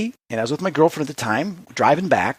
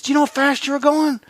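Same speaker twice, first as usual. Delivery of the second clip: a lightly voiced cop, pitched higher; voicing the cop; 12 semitones higher than usual. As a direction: ask it like the officer at the car window.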